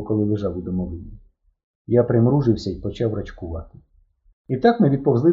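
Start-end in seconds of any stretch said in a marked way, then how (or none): none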